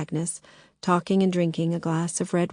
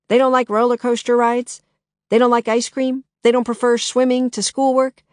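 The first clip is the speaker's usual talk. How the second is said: higher, louder, teasing disbelief; mock incredulity; 6 semitones higher, 7 dB louder than usual. A narrator's reading. Shooks teasing Lily about her kids, mock disbelief. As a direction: needle her with mock surprise.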